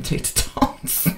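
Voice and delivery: whispered aside. Real, not acted. Joke about Andrew Tate fans.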